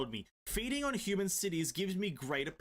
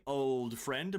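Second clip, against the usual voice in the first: ordinary talk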